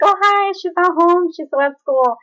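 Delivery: very high, loud put-on greeting; fake-casual grandma. Grandma lying to dad at the door, fake-casual. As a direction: imitate grandma covering with overdone politeness.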